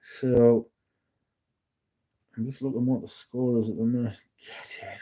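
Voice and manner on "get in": very soft, voiceless little celebration; whispered cheer. Real, not acted. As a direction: celebrate the score under your breath. Celebrating a football score under breath.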